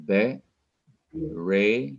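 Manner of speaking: "Be, re" as two separate drawn-out syllables. Shown as a demonstration. Teacher counting out syllables for students, each said separately. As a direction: split "Be, re" with a pause between. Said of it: "these are two".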